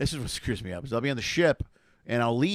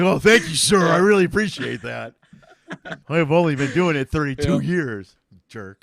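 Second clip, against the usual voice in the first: sarcastic thanks; 7 dB louder than usual. Retold or imitated. Comic answering the patronizing fan, louder sarcastic politeness. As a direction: thank him sweetly then sneer. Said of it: "And you're like".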